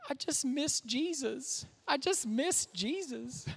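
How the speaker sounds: soft, slow, small tearful voice; lightly voiced sobbing daughter; imitating a crying child. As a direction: mimic a five-year-old weeping for Jesus. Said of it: "Eloise bursts into tears".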